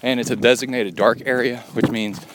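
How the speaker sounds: talking while jogging, breathing hard; while running. Real, not acted. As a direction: speak mid-run on a trail. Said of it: "we're gonna record while I run".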